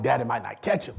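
ordinary talk

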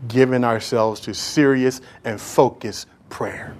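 slow, hushed whisper; slow whispered point. Real, not acted. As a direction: drop to a secret whisper. Preacher drops to whisper.

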